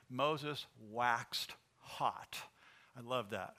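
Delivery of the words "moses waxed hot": slow savoring read. Shown as a demonstration. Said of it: "I love what it says".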